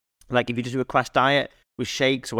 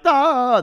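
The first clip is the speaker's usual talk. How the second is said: high loud mock wail of giving in; mock anguished cry; 8 dB louder than usual. Jack caving to the chocolate, loud high mock wail. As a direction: cry out as temptation wins.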